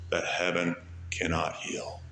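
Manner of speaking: soft whispered last line. Acted. Preacher to the congregation, whispered hymn ending.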